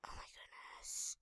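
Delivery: breathless hushed whisper; a scared little whisper. Real, not acted. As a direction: whisper it so nobody hears. Hiding from TNT player.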